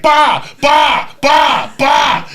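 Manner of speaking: loud, high hammed-up bah bah bah; repeated mock yells. Retelling the bar guy's climax, loud mock yells. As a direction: keep yelling it like an explosion. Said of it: "And he's like".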